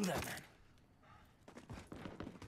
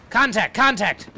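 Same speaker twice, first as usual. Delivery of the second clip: urgent yelled warning; alarm shout; 6 semitones higher, 10 dB louder than usual. Real, not acted. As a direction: bark out the contact call. Calling out enemy in firefight.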